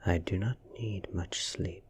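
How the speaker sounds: ordinary delivery, nothing marked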